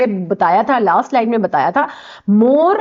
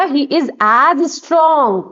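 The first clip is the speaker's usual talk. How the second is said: stretched out, word by word; very slow dictation; 3 semitones higher, much slower than usual. Shown as a demonstration. Teacher dictating the answer to students, very slow. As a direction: lay each word down slowly for them to copy. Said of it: "अब क्या आएगा?".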